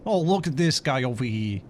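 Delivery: hammed-up Italian shout, loud and high; loud Italian accent. Streamer greets a fellow Italian, loud mock Italian accent. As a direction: bellow like a cartoon Italian. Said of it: "The Italian accent".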